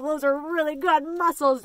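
ordinary talk, nothing marked

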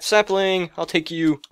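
usual speaking voice

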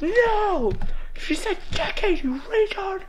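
high-pitched indignant whine; exasperated protest. Real, not acted. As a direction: argue back in high-pitched frustration. Argues furiously with the program's verdict.

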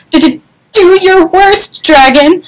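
high, stammering nervous challenge; stutter, then squeak out the demand; scared little storybook knight; squeaky knight. A narrator's reading. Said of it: "squeaked a thin".

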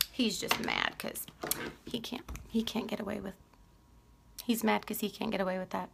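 hushed, unvoiced gossip about her husband; whispered tease. Real, not acted. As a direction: whisper it like a secret.

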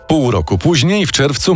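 ordinary delivery

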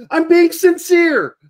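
emphatic protest. Retold or imitated. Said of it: "and I'm like".

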